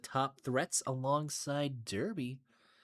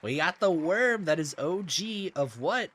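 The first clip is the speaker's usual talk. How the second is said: louder, higher player introduction; hyped announcer; 6 semitones higher, 7 dB louder than usual. Real, not acted. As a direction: announce the contender with big hype. Announcing the next player in matchup.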